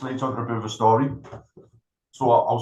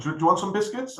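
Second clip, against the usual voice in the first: much higher, breezy hostess voice; friendly receptionist; 9 semitones higher than usual. Retold or imitated. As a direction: play the overly helpful front desk. Receptionist offering biscuits, retold.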